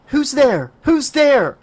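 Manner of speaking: high, panicked call into the dark; frightened calling out. Acted. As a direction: call out, scared. Terrified girl calling out.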